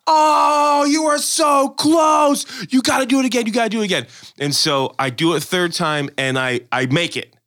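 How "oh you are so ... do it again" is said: excited cheering shout. Retold or imitated. Kids yell to the skater that he's so close, excited shout. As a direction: shout it like a kid who can't believe how close you got. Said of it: "And they're like".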